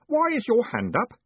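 bright, high eager voice; cheerful young clerk. Acted. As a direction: chirp it up like a keen boy. Stanley's high voice again.

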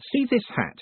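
high, testy challenge; restrained anger. A narrator's reading. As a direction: hold the temper back, pitch jumping up. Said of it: "Moist tried not to get angry".

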